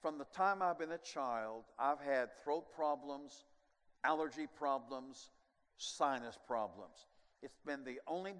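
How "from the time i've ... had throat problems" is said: usual speaking voice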